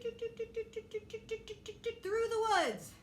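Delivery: high, quick doot doot patter; running sound. Acted. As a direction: mimic little feet racing away. Running sound effect in story.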